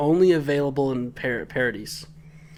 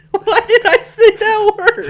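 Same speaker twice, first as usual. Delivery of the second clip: laughing; 10 dB louder than usual. Real, not acted. Laughing at own slip.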